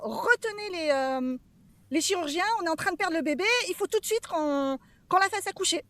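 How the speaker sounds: imitating the nurse calling for the surgeons; retold yell. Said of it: "elle hurle dans le couloir".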